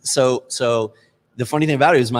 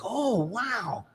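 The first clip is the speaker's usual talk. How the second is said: 1 imitating Mike's awe; 10 semitones higher, 8 dB quieter than usual. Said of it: "And you were like".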